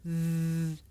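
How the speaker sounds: Th. with tongue tip between the teeth; th sound. Shown as a demonstration. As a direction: show Th. as a slow held buzz.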